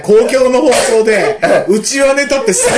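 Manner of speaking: laughing. Real, not acted. Laughing at the joke.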